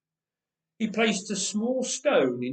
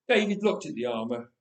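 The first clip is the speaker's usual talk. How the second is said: ordinary delivery, nothing marked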